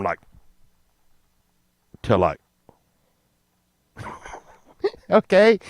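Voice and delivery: ordinary delivery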